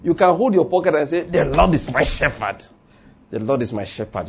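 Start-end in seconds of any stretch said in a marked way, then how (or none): 1.2-2.6 slow, quarrelsome declaration; quarrelling. Shown as a demonstration. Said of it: "Quarrel if you like". 3.3-4.3 low, soft self-talk; to yourself. Shown as a demonstration. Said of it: "Say it to yourself".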